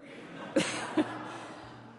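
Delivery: a real, breathy laugh at her own mistake; laughing at herself. Real, not acted. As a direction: laugh it off, embarrassed at the mix-up.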